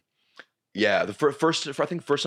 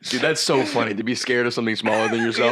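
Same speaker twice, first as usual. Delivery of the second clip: laughing. Real, not acted. Laughing at gnome nightmare.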